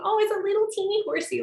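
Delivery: put-on high, fast cooing over a teeny horse; cutesy gushing. Shown as a demonstration. Teacher voicing the adorable-horse thought, high and quick. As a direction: gush like it's adorable. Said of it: "you're thinking".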